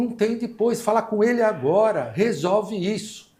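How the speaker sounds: mais aguda e alta, exigindo na hora; exigência brava. Retold or imitated. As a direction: insiste sem recuar, bem mais aguda. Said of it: "Ela falou". (funcionária exige que resolvam agora, brava e alta)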